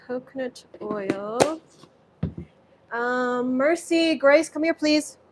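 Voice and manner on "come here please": normal talking voice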